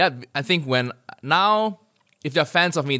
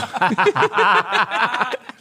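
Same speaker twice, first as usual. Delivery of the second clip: high cackling laugh; 13 semitones higher, 5 dB louder than usual. Real, not acted.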